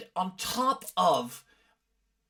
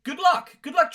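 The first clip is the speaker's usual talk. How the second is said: sarcastic; 12 semitones higher, 8 dB louder than usual. Real, not acted. Mocking the tip.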